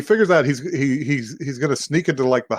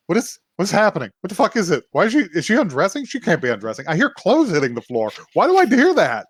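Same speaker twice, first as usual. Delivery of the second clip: high, rushed, spiralling questions; mock panic; 6 semitones higher, faster than usual. He hears clothes hitting the floor, acted panic. Acting character panicking behind turned back.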